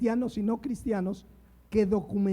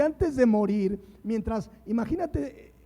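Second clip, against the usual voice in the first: ordinary talk, nothing marked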